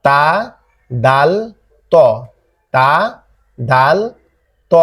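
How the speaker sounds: তা দাল ত said from the tongue tip, slow and separate; name তা, দাল, ত one by one; letter names demo. Shown as a demonstration. Teacher introduces three tongue-tip letters, slow and clear. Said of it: "তা, দাল, ত এই তিনটা অক্ষরকে শিখি".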